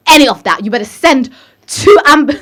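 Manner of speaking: loud, defiant demand; loud refusal. Retold or imitated. Sister refusing, demanding ambulance.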